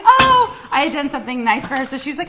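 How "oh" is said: excited gasp. Retold or imitated. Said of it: "Chrissy was like".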